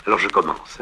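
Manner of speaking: ordinary talk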